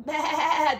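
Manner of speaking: plain reading voice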